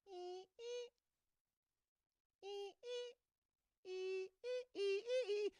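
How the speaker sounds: high squeaky noises. Retold or imitated. Teen behind door again.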